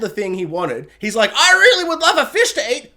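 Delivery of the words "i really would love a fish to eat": mock whiny peasant.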